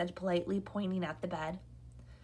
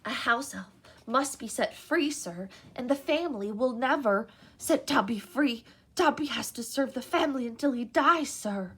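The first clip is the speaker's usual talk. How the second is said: eager house elf; 4 semitones higher than usual. A narrator's reading. House elf meeting Harry, high and eager. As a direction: gush like an awed little elf.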